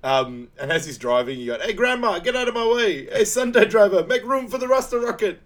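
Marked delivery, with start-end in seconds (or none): 1.6-5.4 high, loud heckling from the driver's seat; shouting at drivers. Retold or imitated. Quoting Sanka as he's driving, high mock road yelling. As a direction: yell at slowpokes to move over.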